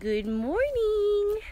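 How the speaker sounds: high, slow drawn-out good morning; high sing-song morning greeting. Real, not acted. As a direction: croon it to the dog.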